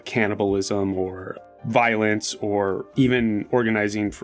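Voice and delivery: ordinary delivery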